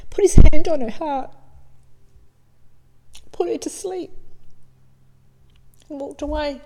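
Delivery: high, tearful and choked up; fighting back tears while telling it; real emotion, voice cracking on a tender memory; voice breaking. Real, not acted. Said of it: "still makes me want to cry".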